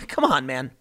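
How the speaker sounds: ordinary delivery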